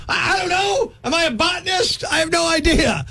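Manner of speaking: high-pitched, stammering indignation; flustered exasperation. Acted. Customer answering checkout's banana question, flustered exasperation. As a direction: throw up questions in a high, baffled voice.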